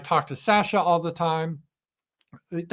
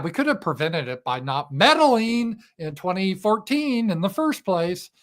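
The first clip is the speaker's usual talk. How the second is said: ordinary talk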